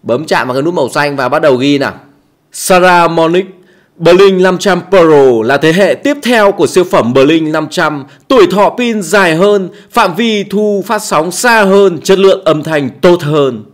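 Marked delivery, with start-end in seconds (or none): 2.5-13.6 slow, polished product-ad voiceover; demo voiceover read. Shown as a demonstration. Tutor demonstrating a voiceover for the mic promo video, ad read. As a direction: sell the mic like a TV ad, unhurried. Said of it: "làm mẫu cho anh em".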